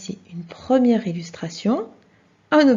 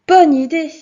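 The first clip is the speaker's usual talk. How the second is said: praise; 6 semitones higher, 9 dB louder than usual. Acted. Praising pupil's idea.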